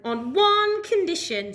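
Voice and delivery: high, slow parrot; stern parrot setting terms. Acted. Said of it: "said Parrot".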